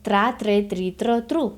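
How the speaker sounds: "Tra, tre, tri, tro, tru" each spoken separately; "Tra, tre, tri, tro, tru" as a careful demo; blend syllables one by one. Shown as a demonstration. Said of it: "sílabas trabadas".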